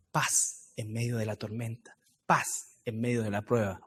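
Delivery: low, soft promise of peace; quietly comforting, well below preaching level; hushed reassurance. Real, not acted.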